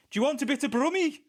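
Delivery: a badly faked Brummie accent; a quick, clumsy Brummie impression. Co-caster trying out a bad Brummie accent in reply. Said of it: "That was bad".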